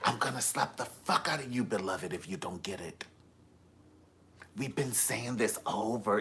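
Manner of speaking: lightly voiced soft-spoken spiritual guru calling you beloved; a hint of a TV life coach, hushed and preachy; mock guru threatening you, beloved, in a soft hush; Iyanla Vanzant. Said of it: "talking like a Iyanla Vanzant".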